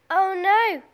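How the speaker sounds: high, loud cry of dismay. A narrator's reading. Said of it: "cried Lola".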